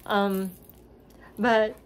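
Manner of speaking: usual speaking voice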